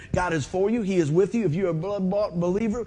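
ordinary delivery

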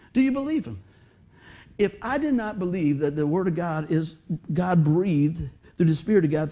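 ordinary talk, nothing marked

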